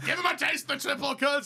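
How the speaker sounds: yelled gangster line; shouting. Pretend. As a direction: bark it out. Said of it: "yelling Tommy in Arthur Shelby's voice".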